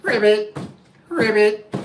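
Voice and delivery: frog croak. Acted. Frog waking.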